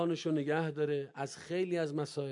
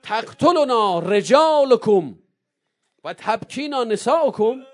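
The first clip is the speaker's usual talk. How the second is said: slow, loud, higher proclamation; Zeinab's cry; 7 semitones higher, 11 dB louder, slower than usual. Acted. Zeinab crying out to the people of Kufa, loud and slow. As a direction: declaim it like a defiant cry in the square. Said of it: "اومد وسط کوفه فریاد زد".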